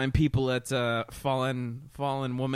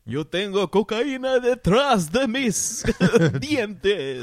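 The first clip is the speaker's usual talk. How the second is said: hammy vampire, shouted ending; Dracula voice; 7 semitones higher, 6 dB louder than usual. Acted. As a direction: shout the last words, slowly. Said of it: "Salvi Dracula".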